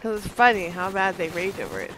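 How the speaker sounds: ordinary delivery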